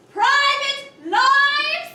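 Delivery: "private lives" hugely drawn out; portentous title drop. Acted. Wife describing the honeymoon balconies, "private lives" grandly drawn out. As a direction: stretch both words of "private lives" very slowly. Hammy naming of the play's title.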